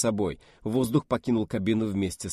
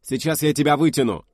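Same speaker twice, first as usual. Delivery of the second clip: urgent shout; 5 semitones higher, 6 dB louder than usual. Acted. Rescue attempt, urgent.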